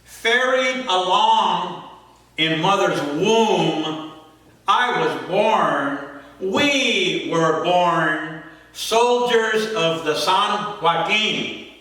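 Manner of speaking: slow, drawn-out incantatory poem reading; poem recitation. Acted. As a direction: recite solemnly, stretching words, pausing between lines.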